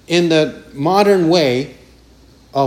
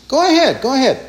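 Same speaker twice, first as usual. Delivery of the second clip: high mocking dare; sneering go ahead; taunting; 7 semitones higher, faster than usual. Shown as a demonstration. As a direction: taunt them, egging them on. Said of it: "To taunt means".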